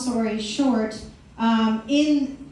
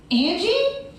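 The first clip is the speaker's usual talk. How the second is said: mock surprised call; 8 semitones higher than usual. Cousin calls her name.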